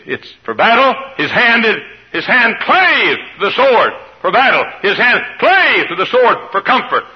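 high, loud shouted preaching; full-force preaching. Real, not acted. To the congregation, a loud, high preaching climax. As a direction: hammer each why home.

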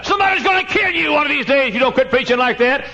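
high, loud mock warning; urgent warning. Someone warns a preacher to quit, high loud warning.

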